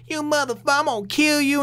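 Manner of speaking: high mock threat yelled at the robot. Reviewer voicing a hypothetical Toriko yelling threats, mock shout. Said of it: "just yelling all these".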